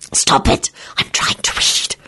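hissed whisper; whisper yelling. Acted. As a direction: hiss it under her breath. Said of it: "she hissed".

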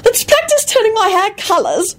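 high, excited squeal. A narrator's reading. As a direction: squeal it with delight. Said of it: "Rosie squealed excitedly".

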